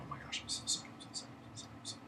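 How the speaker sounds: whispered, repetitive mock apology; hushed apology. Manager squaring it up with the upset customer, whispered apology. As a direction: imitate frantic apologising under the breath, fading away.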